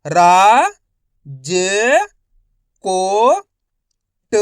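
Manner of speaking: रा, ज, को, ट held singly with pauses between; syllable-by-syllable reading. Shown as a demonstration. Teacher modelling for learners, रा ज को ट one syllable at a time. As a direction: sound out रा... ज... को... ट, long and separate. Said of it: "एक एक अक्षर का उच्चारण करके".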